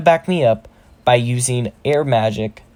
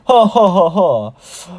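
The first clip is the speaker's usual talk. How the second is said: slow, high villain ha-ha; big measured mock boss laugh; orc shaman's laugh; 6 semitones higher, 5 dB louder, slower than usual. Orc shaman amused by Deku's boast, slow hammed-up laugh. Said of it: "The orc shaman laughs".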